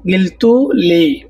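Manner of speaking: গিলতুলি said slowly, syllable by syllable; slow model of গিলতুলি for learners; slow teaching demo. Shown as a demonstration. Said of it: "তোমরা আমাকে বলেছ".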